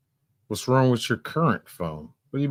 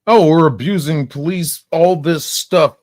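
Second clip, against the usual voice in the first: mocking imitation; 9 semitones higher, 9 dB louder than usual. Mocking her claim.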